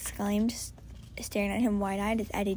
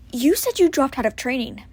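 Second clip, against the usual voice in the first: louder, hurt angry reproach; angry sister; 3 semitones higher, 10 dB louder than usual. Acted. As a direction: hold back tears while accusing. Maddie angry, holding back tears.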